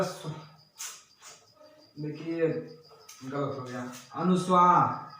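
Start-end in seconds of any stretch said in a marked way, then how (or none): 0.0-3.9 muttered correction. Real, not acted. Teacher admits a mistake.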